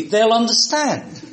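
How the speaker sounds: ordinary talk, nothing marked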